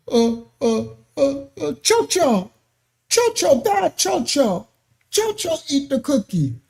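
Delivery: stall, then pin it on the dog with fake innocence; child blaming dog. Preacher voicing a child blaming the dog, mock innocent child.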